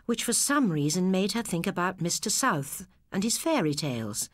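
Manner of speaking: ordinary delivery, nothing marked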